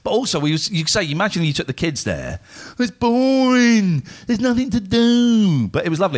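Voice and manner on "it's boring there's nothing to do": put-on whining bored kid. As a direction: whine it out like a bored child. Imagined kids complaining at the beach.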